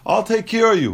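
smooth it over like a salesman closing the deal; reassuring seller. Retold or imitated. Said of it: "He says".

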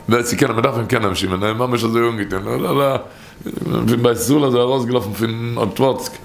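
usual speaking voice

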